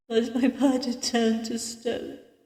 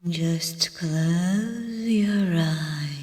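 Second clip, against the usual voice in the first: very slow, hushed, lower command; commanding dream voice; 4 semitones lower, much slower than usual. Acted. Mysterious voice to the dreamer, slow hushed command. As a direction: lull the listener with long, slow words. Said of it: "I followed the voice's command".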